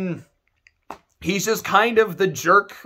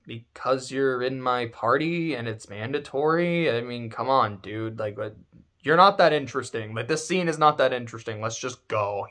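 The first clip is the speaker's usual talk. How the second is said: bored Zidane; 8 semitones lower, 5 dB quieter than usual. Retold or imitated. Zidane voiced, bored dismissal.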